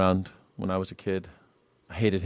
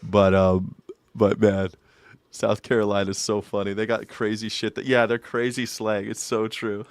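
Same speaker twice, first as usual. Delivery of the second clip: ordinary talk, nothing marked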